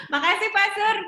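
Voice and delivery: thanks through a high laugh. Real, not acted. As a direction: laugh while thanking him. Laughing thanks after joke.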